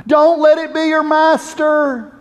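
high, slow impassioned cry. Acted. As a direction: cry out a warning slowly, voice lifted high. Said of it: "Samson's voice as he cries out".

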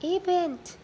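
high, loud alarmed question; alarmed cry. A narrator's reading.

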